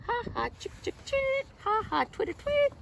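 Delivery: high, giggly bird chatter; high playful chirping; swallow twitter. Acted. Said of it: "The swallow slides from under".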